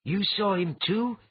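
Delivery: high, loud, slow shaky question; asking with fear in the voice; trembling voice. A narrator's reading. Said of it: "his voice also trembling".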